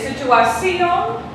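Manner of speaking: situación as situa-CI-on, slow with questioning rise; misplaced stress on ci. Shown as a demonstration. Teacher offers a third possibility, exaggerated stress on CI in situación. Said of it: "where is the emphasis of my voice".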